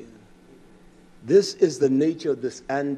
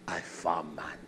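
whisper; 9 semitones lower, 10 dB quieter than usual. Retold or imitated.

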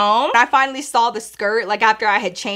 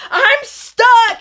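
pitched way up, helpless and whiny; whiny plea; 11 semitones higher, 3 dB louder than usual. Retold or imitated. To her boyfriend David, a high helpless whine. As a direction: whine it up high like a stuck kid. Telling boyfriend she's stuck.

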